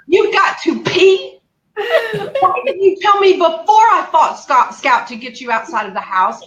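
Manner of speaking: high, exasperated re-enacted scolding, settling by the end; retold yelling. Daughter scolds mom outside the house, mock yelling. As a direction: start high and incredulous, then come down. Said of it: "I started yelling at my mom".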